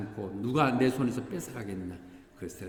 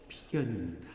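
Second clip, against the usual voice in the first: usual speaking voice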